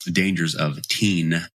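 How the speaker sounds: ordinary talk, nothing marked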